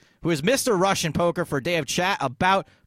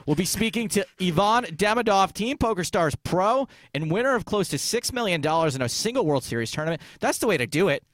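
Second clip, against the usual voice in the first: usual speaking voice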